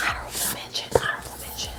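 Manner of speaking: whispered, barely any voice; furtive whisper. Real, not acted. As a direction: sneak it out under your breath. Sneaking in picks after saying bye.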